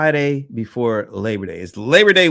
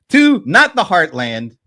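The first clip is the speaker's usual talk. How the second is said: much higher and louder, slow; laughing hype; 8 semitones higher, 5 dB louder, slower than usual. Real, not acted. Host moving on to the next guest, laughing and hyped. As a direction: ride the laugh into the next intro.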